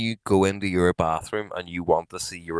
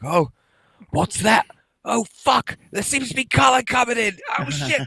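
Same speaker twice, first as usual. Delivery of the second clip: high, frantic yelps of alarm; panicked Aussie presenters. Retold or imitated. Two Australian TV presenters watching colour appear, high panicked yelps. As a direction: squeal like a caught-out presenter. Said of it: "two fellas pissing about being like".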